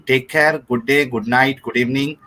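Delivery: ordinary talk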